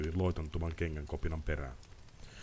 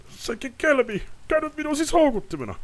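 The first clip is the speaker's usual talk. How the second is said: very high, loud furious cursing; tied-up lord yelling curses into the dark; bound nobleman screaming; 9 dB louder than usual. Acted. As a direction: rage against the ropes. Ostrid outraged at being bait.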